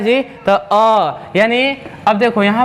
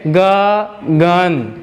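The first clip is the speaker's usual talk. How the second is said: drawn out word; 2 semitones lower, much slower than usual. Shown as a demonstration. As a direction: stretch गगन very slowly, every sound held. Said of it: "थोड़ा सा voice पे concentrate".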